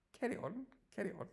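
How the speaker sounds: very high posh English "Carry on"; posh accent. Shown as a demonstration. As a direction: show off a fluty, high-pitched posh accent. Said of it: "If you wanna be a posh English speaker".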